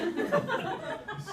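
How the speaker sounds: squeaky burst of laughter; high laugh. Real, not acted. Laughs at own joke.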